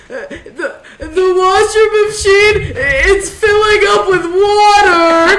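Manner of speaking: long, high, loud wail; stammer, then bawl it slowly, hammed up; mock crying. Sister imitating brother reporting the filling washer, exaggerated blubbering. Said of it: "he's crying and he goes".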